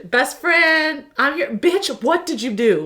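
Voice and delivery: high-pitched best-friend telling-off; mock scolding. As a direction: playfully chew out a friend. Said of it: "He's, like, yelling at her".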